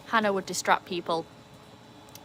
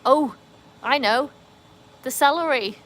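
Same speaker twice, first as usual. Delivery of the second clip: louder, higher burst of excitement; excited idea; 6 semitones higher, 9 dB louder than usual. Real, not acted. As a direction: blurt it out, thrilled.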